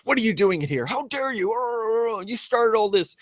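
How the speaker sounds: hammed-up scolding of a foe; mock outrage. Acting a confrontation before a fight.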